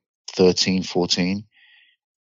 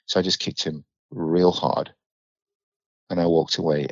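ordinary delivery

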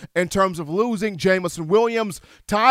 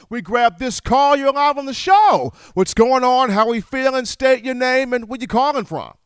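radio host call intro; 5 semitones higher, 4 dB louder than usual. Acted. Host takes a new caller.

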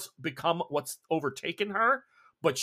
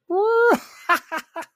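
high, loud whoop into a cackle; villain's wild laugh; 9 dB louder than usual. Acted. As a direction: howl with predatory glee, far ahead. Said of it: "And then you hear".

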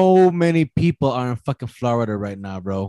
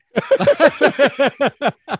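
big real burst of laughter; loud high laugh; 7 dB louder than usual. Real, not acted. Man bursts out laughing at his own joke.

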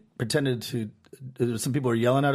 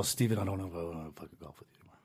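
muttering dad; 9 semitones lower, 11 dB quieter, faster than usual. Retold or imitated. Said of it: "He's all".

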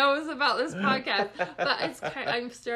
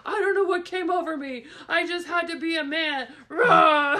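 loud, higher-pitched hammed-up bragging; mock macho man; 5 semitones higher, 8 dB louder than usual. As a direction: build to a roaring comic-book Hulk parody. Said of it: "and he was like".

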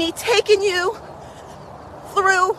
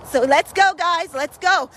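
ordinary talk

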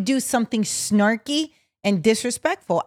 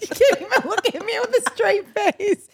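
much higher, through laughter; laughing; 9 semitones higher than usual. Real, not acted. Co-host calling out her friend's straight face, laughing. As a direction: crack up while calling out the friend.

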